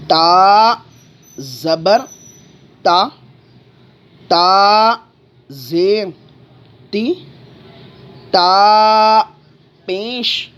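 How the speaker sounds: chanted letter drill, "تا" held long; teacher's sing-out drill leaving gaps for repetition; spelling-out drill. Shown as a demonstration. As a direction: spell out each piece separately with pauses, stretching "تا". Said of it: "لیکن ہم ہجے سے اسی لیے پڑھیں گے".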